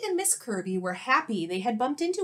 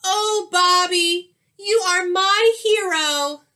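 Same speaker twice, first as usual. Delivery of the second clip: much louder, higher and slow gushing; gushing gratitude; 9 semitones higher, 10 dB louder, slower than usual. Acted. Teacher gushing to Bobby who saved her hat, overjoyed. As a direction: swoon over him with big thanks.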